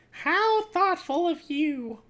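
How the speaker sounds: high, slow, drawn-out mom voice; badly voiced mom. Mom answers the kid's dusting, high put-on mom voice. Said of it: "that's not a good voice".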